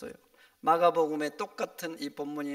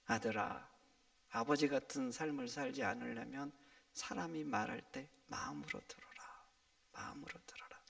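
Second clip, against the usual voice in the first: low, hushed deathbed words, fading to a whisper; a dying father's last advice, voiced very softly; 7 semitones lower, 10 dB quieter than usual. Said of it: "죽음이 가까웠을 때 자기 아들을 불러요".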